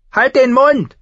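high, loud snapping prince; shouting back. Acted. The prince shouts back at Arya, high and loud. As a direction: yell it back at her. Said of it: "schrie der Prinz zurück".

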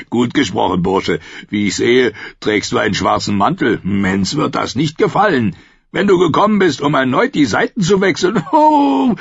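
boisterous Tormund, mocking and glad; booming wildling chief. A narrator's reading. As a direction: greet him like an old rough friend. Tormund voiced.

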